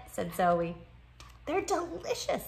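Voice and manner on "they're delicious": talking through a mouthful; mouth full. Acted. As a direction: say it round a bite of food. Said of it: "said Lola with a mouthful".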